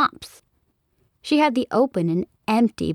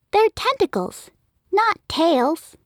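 put-on much higher child's voice; a small girl setting them straight; high, matter-of-fact kid voice; little girl correcting; 11 semitones higher than usual. Child corrects her parents.

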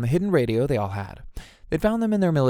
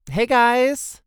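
high, loud, breezy greeting; cheery call; 12 semitones higher, 5 dB louder than usual. A narrator's reading. Tara greeting the group as she gets closer, cheery call. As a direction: call out cheerily to friends some way off. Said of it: "Tara called out cheerily".

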